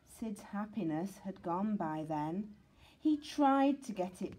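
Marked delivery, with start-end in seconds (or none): none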